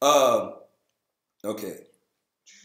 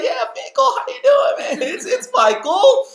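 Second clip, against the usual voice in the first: much higher, shouted buddy hello; over-the-top greeting; 6 dB louder than usual. Acted. Isaac hailing Phicol, shouting with fake cheer. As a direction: yell it across the camp to an old pal. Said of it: "So Isaac could be like".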